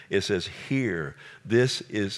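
ordinary talk

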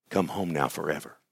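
low, soft summons home; gentle call. Acted. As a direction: invite quietly and tenderly. God calling him home, gently.

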